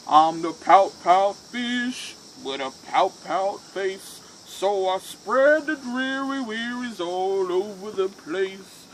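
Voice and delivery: loud, slow, gloomy fish with a long sighing bloom; glum pout pout fish. Acted. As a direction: mope it out, then let the blooms sink and fade. Reads the glum fish's refrain.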